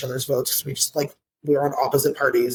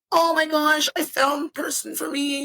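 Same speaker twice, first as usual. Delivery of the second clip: high-pitched imitation of a smitten friend; mock lovestruck gushing; 10 semitones higher than usual. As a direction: gush as if love just struck, mockingly. Acts friend's fake surprise at love.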